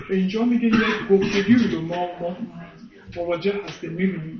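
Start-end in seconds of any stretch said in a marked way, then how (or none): none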